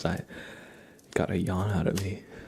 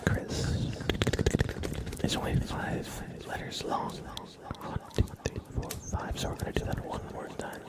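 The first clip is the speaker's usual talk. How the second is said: ASMR whisper; slower than usual. Acted. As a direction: breathe the words close to the mic. ASMR name trace whispered.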